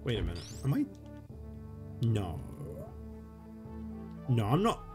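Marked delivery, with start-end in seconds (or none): none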